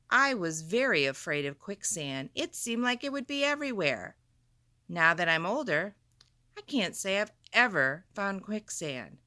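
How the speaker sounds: ordinary delivery, nothing marked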